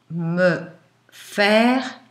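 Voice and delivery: normal talking voice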